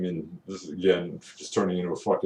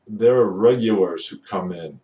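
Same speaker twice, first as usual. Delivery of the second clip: usual speaking voice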